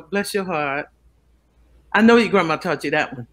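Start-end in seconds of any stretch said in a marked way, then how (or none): 0.0-0.9 sweetly sarcastic. Retold or imitated. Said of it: "make you go".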